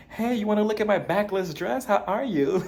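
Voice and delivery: hammed-up flirty welcome at the door; mock flirty greeting. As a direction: imitate someone fishing for attention. Imitating Brynn showing off at door.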